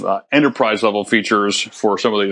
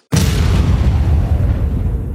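laughing. Real, not acted. Laughs after joke about billing.

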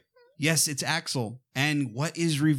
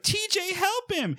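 high-pitched cry for help; mock urgent plea. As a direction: imitate someone frantically calling to a friend. Acted plea to a character.

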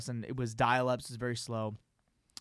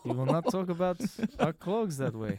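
put-on Russian accent; 3 semitones higher, 3 dB quieter than usual. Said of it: "Vladimir Putin is like".

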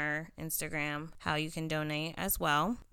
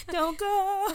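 mock plea; 5 dB louder than usual. Begging listeners to stay.

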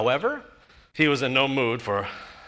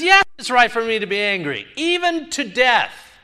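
defiant Jonah; 7 semitones higher, 4 dB louder than usual. Retold or imitated. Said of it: "he says this".

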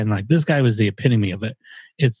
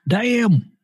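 Damn pitched higher, falling at the end; higher repeat; 10 semitones higher than usual. Real, not acted. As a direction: jump up higher on the second Damn. Second take on his age.

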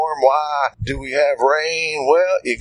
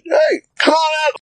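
calling out; 12 semitones higher than usual. Retold or imitated. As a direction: hail him from a distance. Jesus calling to Peter.

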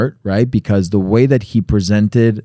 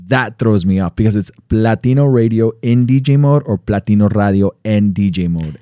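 ordinary talk

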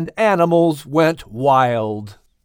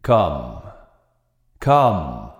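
much lower, slow call; deep mysterious voice; 11 semitones lower than usual. A narrator's reading. Unseen voice calling Max, very low and grave. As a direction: intone it like a voice from above. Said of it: "spoke the voice".

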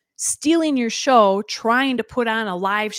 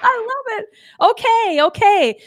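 excited shout; 12 semitones higher, 6 dB louder than usual. Real, not acted. Cheering sound of applause.

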